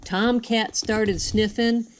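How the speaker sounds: usual speaking voice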